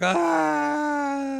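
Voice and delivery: high hammed-up cry of defeat; a cartoon villain's anguished yelp; mock villain wail. Defeated Duke crying out, hammed-up high wail.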